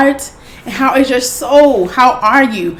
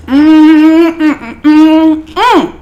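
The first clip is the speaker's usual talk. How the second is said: long, raised "mmm" of pleasure; delighted food hum; 4 semitones higher, 4 dB louder, much slower than usual. Real, not acted. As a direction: hum high with delight at the taste. Savouring the first bites.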